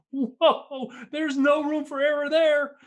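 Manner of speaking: high, slow, stunned exclamation; awed whoa. Retold or imitated. Imagining watching a wingsuit flyer thread a rock, awed high whoa. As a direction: gasp it out as if watching the flight.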